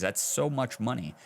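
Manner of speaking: ordinary talk, nothing marked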